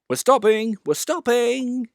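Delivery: called out. A narrator's reading. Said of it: "called Thomas".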